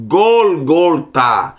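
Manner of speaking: Gólgota said slowly with open O vowels, never Gálgata; O sounds, not A. Shown as a demonstration. Teacher corrects the students' Gálgata, slowly. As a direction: give Gólgota its O vowels, slow and clear. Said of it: "por que que não é Gálgata?".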